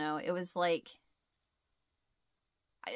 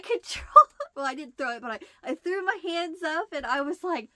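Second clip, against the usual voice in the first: ordinary delivery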